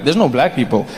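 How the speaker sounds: low, subdued, uneasy realisation; note it warily, as if something happened; ominous understated observation; the bad way. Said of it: "then there's some places you go".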